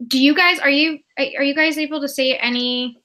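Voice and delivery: ordinary delivery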